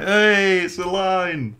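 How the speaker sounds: high, loud delighted whoop; excited cheer. Retold or imitated. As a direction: cheer like the fans in the room. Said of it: "everyone's like".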